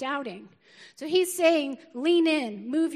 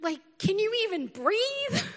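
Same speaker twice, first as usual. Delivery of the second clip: high-pitched mock teenage complaint; exasperated teen question, pitch shooting up; irritated teenager; 8 semitones higher, 3 dB louder than usual. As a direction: imitate an incredulous teen griping at Mom. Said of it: "irritated 'cause they're teenagers".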